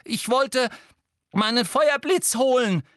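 high, loud strained protest; croaking. A narrator's reading. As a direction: force the excuse out in a croak. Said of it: "krächzte Harry".

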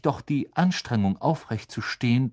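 ordinary delivery, nothing marked

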